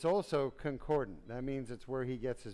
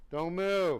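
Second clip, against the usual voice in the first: firm call to patient; 4 semitones higher, 6 dB louder than usual. Real, not acted. Doctor calls to sedated patient.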